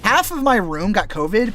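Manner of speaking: normal talking voice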